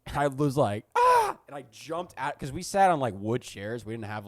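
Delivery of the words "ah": high, loud startled "ah"; sudden frightened shriek; startled scream. Retold or imitated. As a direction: jolt awake with a yelp. Startled awake by teacher.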